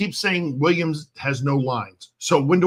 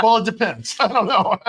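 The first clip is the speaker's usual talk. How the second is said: laughing; 6 semitones higher than usual. Real, not acted. Laughing at own innuendo.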